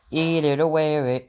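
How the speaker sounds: loud, shrill-free toddler yell in a put-on kid voice; toddler scream. A narrator's reading. Toddler screaming at his father, loud kid voice. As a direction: scream it like a furious little kid. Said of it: "Fudge screamed".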